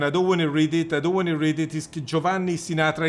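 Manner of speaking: ordinary talk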